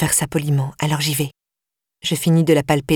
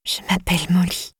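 whispered. A narrator's reading. Captive girl whispers her name to Flora, hushed. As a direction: breathe the name out, barely voiced. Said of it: "a enfin retrouvé sa voix".

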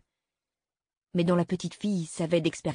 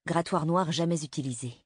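usual speaking voice

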